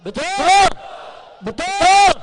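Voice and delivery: loud, high, drawn-out call; rousing call. Real, not acted. Orator asks the rally to agree, rousing shout. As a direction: demand the crowd's answer.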